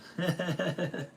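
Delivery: high, rippling chuckle; a run of quick high giggles; real laugh. Real, not acted. Streamer joking about his lookalikes, high chuckling.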